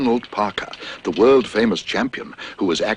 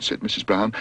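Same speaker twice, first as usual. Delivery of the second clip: ordinary delivery, nothing marked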